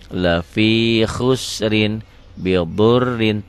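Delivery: lafi khusrin bidurrin recited slowly; recited Arabic. Shown as a demonstration. Teacher reciting an example verse, slow.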